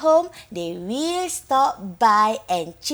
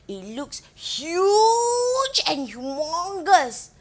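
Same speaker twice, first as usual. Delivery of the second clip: "huge" stretched long and rising high; exaggerated size; 4 semitones higher, slower than usual. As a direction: blow up "huge" with a long, swelling vowel. Child's view of huge sofa.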